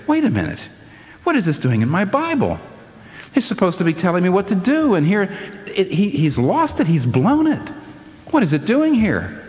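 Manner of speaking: high-pitched, incredulous objection; bothered protest. Retold or imitated. Preacher recalling his old quarrel with scripture, bothered protest. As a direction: re-enact being bothered, questions pitched high. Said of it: "used to bother me so much".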